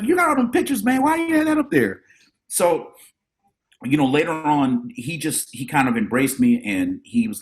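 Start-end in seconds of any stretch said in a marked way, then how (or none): 0.0-2.0 put-on kid voice. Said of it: "I was like".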